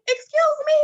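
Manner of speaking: very high, loud, affronted exclamation; offended retort. Retold or imitated. Retelling her retort to the nurse, affronted. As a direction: act out being utterly taken aback.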